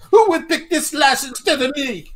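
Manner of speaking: high, loud, drawn-out mock Australian whine; Australian accent. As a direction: put on an over-the-top Aussie accent. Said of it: "That's my Australian accent".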